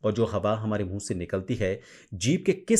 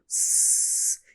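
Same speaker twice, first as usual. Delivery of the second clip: unvoiced drawn-out स like a mother to a toddler; hissing s. Shown as a demonstration. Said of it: "इस तरह की sound करती हैं वो". (लंबी फुसफुसाती स ध्वनि)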